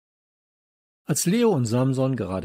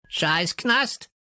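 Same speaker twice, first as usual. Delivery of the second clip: high, squawky screech; parrot screech; 8 semitones higher than usual. A narrator's reading. Parrot answering his old friend Josef, screeching. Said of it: "kreischte Störtebeker".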